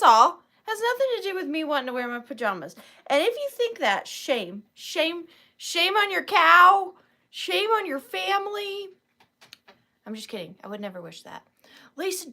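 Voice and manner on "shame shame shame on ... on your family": playful scolding, building louder; mock shaming. Streamer scolding doubting viewers, mock indignation. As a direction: draw out a pretend curse.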